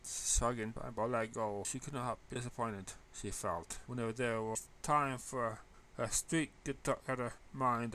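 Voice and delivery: ordinary delivery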